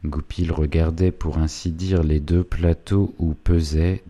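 ordinary delivery, nothing marked

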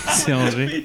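slow, laughing through it; giggling slowly. Real, not acted. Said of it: "Tu vas y arriver".

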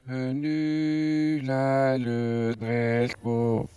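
"Au clair de la lune" sung slowly in reversed syllables; slow reversed phonetic chant of Pierrot; backwards speech. Shown as a demonstration. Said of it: "on va la chanter à l'envers".

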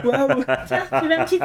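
laughing while offering; chuckling through the words. Real, not acted. Joking offer of a drink.

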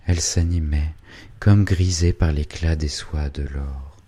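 usual speaking voice

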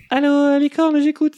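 singsong operator greeting climbing high. Acted. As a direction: sing out the hotline greeting, ending high.

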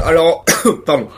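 choking and coughing; words through a coughing fit. Real, not acted. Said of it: "Je m'étouffe, désolé".

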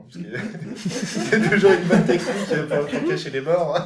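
amused laugh while explaining; laughing at the film's trick. Real, not acted. Amused aside about hiding actors.

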